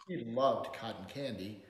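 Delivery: plain reading voice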